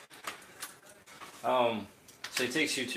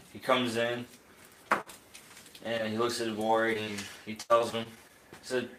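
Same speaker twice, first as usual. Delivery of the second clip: usual speaking voice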